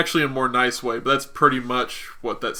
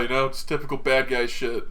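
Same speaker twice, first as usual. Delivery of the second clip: ordinary talk, nothing marked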